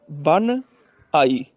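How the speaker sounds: ਬਨ, a pause, then ਆਈ; two separate words. Shown as a demonstration. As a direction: split ਬਨ from ਆਈ, never joined. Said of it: "ਇਹਨੂੰ ਬਨਿਆਈ ਨਹੀਂ ਬੋਲਣਾ".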